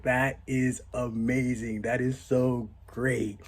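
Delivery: slow, drawn-out amused voice; a hint of a delighted girlfriend; lightly voiced amused date. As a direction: savor each word slowly. Drunk date laughing at story.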